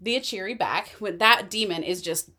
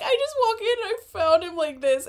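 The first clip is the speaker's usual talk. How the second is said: put-on innocent girl voice; 10 semitones higher than usual. Retelling Ava's innocent excuse.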